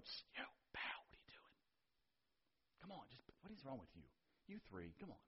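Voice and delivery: urgent stage whisper; hushed scolding. Retold or imitated. Bystander to the three guys left standing, whispered scolding. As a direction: hiss it under your breath at them. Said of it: "And somebody's going".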